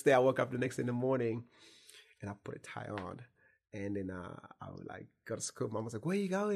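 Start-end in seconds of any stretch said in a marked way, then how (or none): 6.1-6.5 high, surprised mom voice; mom asking. Retold or imitated. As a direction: voice the worried mother, pitched up. Mom voiced asking where son went.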